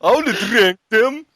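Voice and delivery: high, loud whining protest; mock crying. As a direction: wail like a wronged drunk, hammed up. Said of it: "he starts crying about it".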